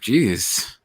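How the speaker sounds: usual speaking voice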